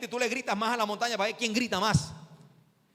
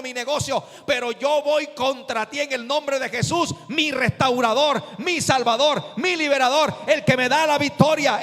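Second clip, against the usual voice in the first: rising to a higher, much louder preaching shout; defiant declaration to the devil; 5 semitones higher, 9 dB louder than usual. Shown as a demonstration. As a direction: proclaim it over the devil, building to a roar. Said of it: "tú le vas a decir al diablo".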